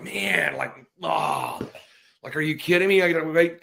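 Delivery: hammed-up impatient child; mock kid frustration. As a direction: whine like a kid who can't wait.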